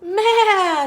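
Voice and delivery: high, loud bleating maa; goat bleat. A narrator's reading. Goat asking to come in, high bleat.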